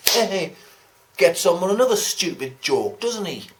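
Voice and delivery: ordinary delivery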